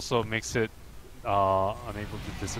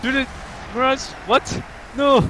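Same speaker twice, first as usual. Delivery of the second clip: startled shout; 13 semitones higher, 5 dB louder than usual. Real, not acted. Ball lost in play.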